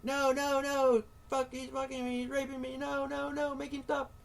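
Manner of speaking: high, loud panicked woman's pleas; mock wife pleading. Mocking a husband's reaction, acting out the wife's high cries. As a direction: squeal it like a terrified wife.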